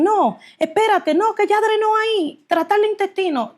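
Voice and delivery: urgent, high-pitched surgeons calling a halt; acted alarm. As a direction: imitate alarmed doctors, pitch jumping well up. Doctors' alarm acted mid-operation. (médicos alarmados, imitados con voz aguda y urgente)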